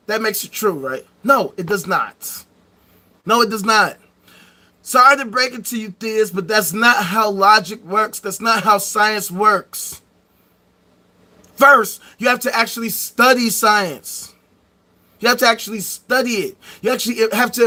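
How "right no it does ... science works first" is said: ordinary talk, nothing marked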